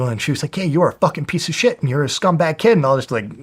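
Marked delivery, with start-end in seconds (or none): 0.6-2.7 retold going off. Wife insulting him in front of the guy, retold going off. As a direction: imitate her going off on you. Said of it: "just going off".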